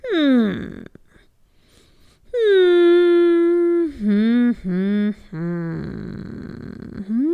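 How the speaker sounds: long pondering hums, fading; thoughtful hmm. Acted. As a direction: ponder aloud, trailing off. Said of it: "he deliberated for quite a while".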